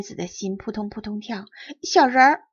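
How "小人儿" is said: excited. Acted. Child excitedly reports tiny people.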